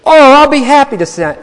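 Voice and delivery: high, loud, happy offer; eager mentor. Retold or imitated. Mentor gladly offers to sign the grant card, high and loud. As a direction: make it a bright, eager yes, high and loud.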